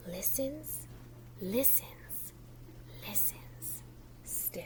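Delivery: fading whispered listens; whispering. A narrator's reading. Teacher reading to children, whisper fading to nothing. Fox listening, story hush.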